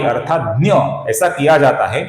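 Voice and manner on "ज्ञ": ज्ञ said as "dnya"; Marathi pronunciation. Shown as a demonstration. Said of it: "Marathi में इसका उच्चारण".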